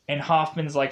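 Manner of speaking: ordinary talk, nothing marked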